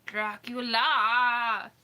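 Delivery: booming mock-spooky name; dramatic Dracula. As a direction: stretch each syllable like a horror count. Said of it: "but can I say it like that?".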